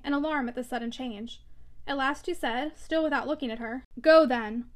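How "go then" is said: loud bitter dismissal; despairing rage. A narrator's reading. Stephen turning from Maggie, loud despairing dismissal. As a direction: send her away, loud. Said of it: "half stunned by despairing rage".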